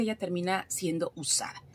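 normal talking voice

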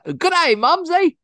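very high, laughing mock British accent. Riffing on Mumsy with a friend, laughing mock British accent. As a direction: chirp it in a silly British accent through a laugh. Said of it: "like, British".

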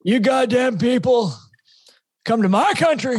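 ranting old hockey commentator, badly done; Don Cherry impression. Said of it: "That wasn't a very good impression".

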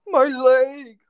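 very high, loud mock wail; exaggerated falsetto cry of pain; cartoonish hurt cry. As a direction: squeal it like a cartoon casualty. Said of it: "I instantly thought of SpongeBob".

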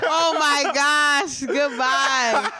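loud, drawn-out laughing reaction; laughing disbelief. Real, not acted. Co-host reacting to the ridiculous skit, laughing loudly. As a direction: cry out slowly through real laughter.

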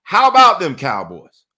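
slow, loud mock fan chant; mocking Cowboys fan. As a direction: ham up the smug Cowboys fan. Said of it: "They say something like".

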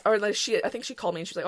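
normal talking voice

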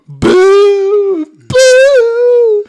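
high, loud drawn-out boos; hammed-up jeering howl; crowd booing. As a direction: boo like a whole outraged audience. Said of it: "all the women in the crowd going".

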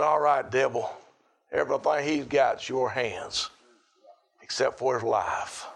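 low solemn voice of God. Acted.

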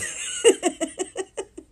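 airy high laugh cutting in; a squeaky disbelieving laugh; high breathy laugh. Real, not acted. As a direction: laugh that barely makes a sound. Laughs mid-rant.

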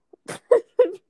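a high, loud burst of laughter at her own line. Real, not acted. Laughing hard at her own comeback, loud high laughter. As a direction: crack up loudly, pitch jumping up.

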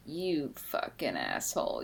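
low, soft under-breath insult; muttered curse. Retold or imitated. Viewer quietly cursing the king on screen, low and soft.

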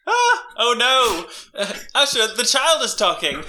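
hammed-up panic at the child; mock panic. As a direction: soup panicking back at the child. Soup panics at alien child.

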